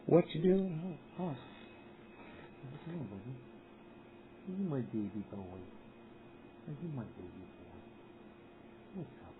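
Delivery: very soft, low, cooing cat talk. Real, not acted. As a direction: coo gently to the kitty.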